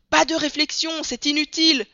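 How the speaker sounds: plus aigu et fort, agacé; l'Américain coupe la parole; coupe brusquement. A narrator's reading. (interruption agacée)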